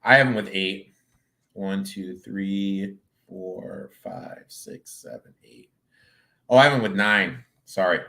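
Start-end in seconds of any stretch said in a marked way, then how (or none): 1.5-5.7 soft count, fading to barely audible; counting to self. Real, not acted. Analyst tallies the predicted wins to himself, fading under-breath count. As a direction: count under your breath, trailing away.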